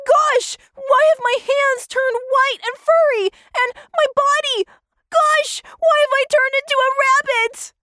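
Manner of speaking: high-pitched, startled questioning; alarmed astonishment. Acted. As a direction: gasp it out in disbelief at your own body. Tommy finds himself turned into rabbit.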